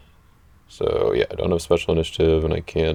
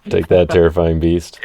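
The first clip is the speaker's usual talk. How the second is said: playful taunt; 11 dB louder, faster than usual. Pretend. Taunting opponent during card play.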